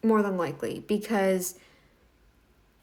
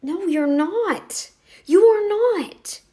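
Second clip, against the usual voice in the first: much higher and louder, slow: You ARE not; emphatic denial; 9 semitones higher, 8 dB louder, much slower than usual. Real, not acted. To the vegan who claims her food gives her vitamins, a loud slow denial. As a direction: spell it out slowly and loudly, leaning on ARE.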